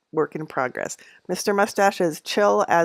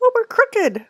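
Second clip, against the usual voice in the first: much higher startled oh; surprised; 3 dB louder than usual. Real, not acted. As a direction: squeak it out in sudden alarm. Noticing the crooked cards.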